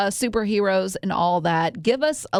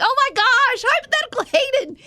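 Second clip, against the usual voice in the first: high, fast mock gushing; mock excitement; 4 dB louder than usual. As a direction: squeal it like a starstruck fan. Mock gushing reaction, retold.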